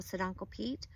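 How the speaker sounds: usual speaking voice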